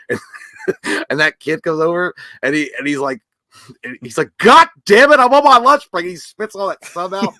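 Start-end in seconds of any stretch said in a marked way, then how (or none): 4.4-6.1 loud, high yelled complaint; mock angry yell. Retelling a movie kid shouting about his lunch break.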